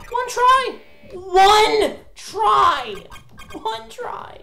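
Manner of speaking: shouting a plea at the game; desperate cry. Real, not acted. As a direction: beg for one try. Pleading for just one try.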